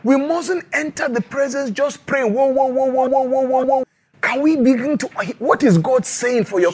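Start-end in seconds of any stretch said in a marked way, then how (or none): 2.3-3.8 rapid monotone "whoa whoa whoa", held high; mock prayer chant. Preacher mimicking people who just pray, mock chanting. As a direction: mimic people praying on and on without listening.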